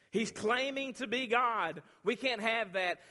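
loud, high, drawn-out accusation; outraged accusers. Acted. Pharisees objecting to Jesus' claim, outraged. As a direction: preach it as an indignant protest.

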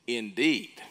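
indeed leaned on with emphasis; emphatic indeed. Shown as a demonstration. Preacher explaining Romans to the church, indeed stressed hard. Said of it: "that's emphatic".

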